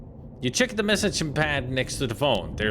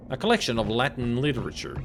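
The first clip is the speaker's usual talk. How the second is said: usual speaking voice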